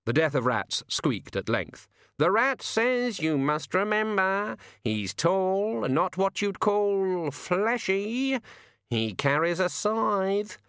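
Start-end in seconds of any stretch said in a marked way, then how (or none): 2.2-10.6 slow, much higher croaking bird voice; talking raven. A narrator's reading. The raven telling Susan her grandfather's gone mad, high slow bird voice. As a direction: speak like a sardonic raven.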